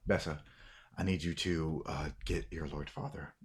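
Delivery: low, soft order; quiet instruction. Acted. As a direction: give the errand privately and softly. Man quietly instructs the woman.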